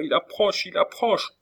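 very high, frightened warning; acted terror, pitch climbing on the repeat; panicked cry. A narrator's reading. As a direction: cry out as danger closes in. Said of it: "s'écria-t-elle, le coup au cœur".

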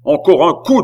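loud, high, final warning; exasperated shout. A narrator's reading. Beausire draws his sword on stranger, exasperated shout. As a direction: burst out at the end of patience.

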